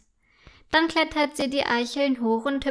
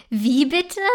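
loud, amused exclamation; a touch of laughter in disbelief; 5 dB louder than usual. A narrator's reading. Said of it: "ruft er lachend".

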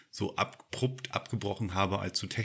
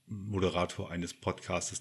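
usual speaking voice

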